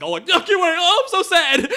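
high, laughing fake sob; mock crying Chewbacca. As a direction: blubber it as a joke, half laughing. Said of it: "He was like".

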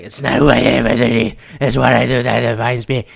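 low quoted movie line, a touch far off; quoting Batman. Retold or imitated. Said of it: "it's like".

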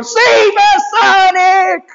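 high loud panicked shout; high panicked cartoon cry; panicked robot. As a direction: screech for help like a cartoon robot. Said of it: "He's going".

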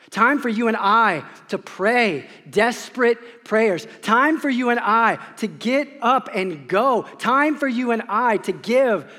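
building, emphatic preaching; impassioned sermon build. Acted. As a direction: drive home each time for you and I. Preaching climax.

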